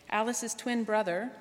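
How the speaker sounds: usual speaking voice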